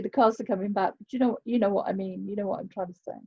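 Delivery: ordinary delivery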